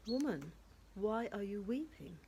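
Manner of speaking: normal talking voice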